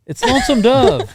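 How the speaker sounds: high, loud burst of disbelief; exasperated reveal. Real, not acted. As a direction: blurt the answer out.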